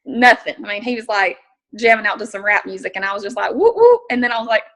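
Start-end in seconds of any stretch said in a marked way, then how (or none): 3.5-4.0 very high, loud hype call; party whoop. Retold or imitated. Joining the driver's rap music, a loud high whoop. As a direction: whoop it up like you're vibing to rap.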